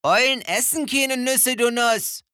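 a know-it-all mole scoffing; mole teasing. A narrator's reading. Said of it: "sagte der Maulwurf".

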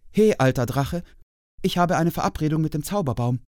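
a small hare standing his ground; brave little hare. A narrator's reading. Little hare bravely addresses dragon.

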